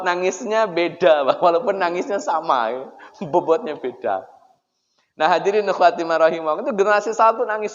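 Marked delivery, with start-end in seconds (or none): none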